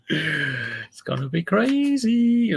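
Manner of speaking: high laugh, then slow words; laugh, then draw it out. Real, not acted. Joking with Lex about the script, laughing.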